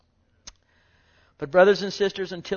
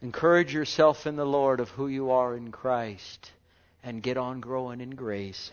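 normal talking voice

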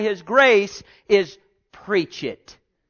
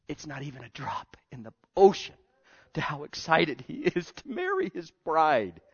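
fast, much softer breathy hush; awed whisper; 6 semitones lower, 7 dB quieter than usual. Real, not acted. Preacher confides Jesus' joy to the church, whispering. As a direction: let it slip out like a secret.

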